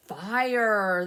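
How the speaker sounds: a loud called-out fire; a demo shout of the word fire. Shown as a demonstration. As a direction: call it out like a warning to the grown-ups. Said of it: "So what did they shout?".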